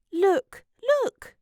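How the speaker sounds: high-pitched exclamation of discovery; excited cry. A narrator's reading. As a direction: cry out to point something out. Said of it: "cried Diggory".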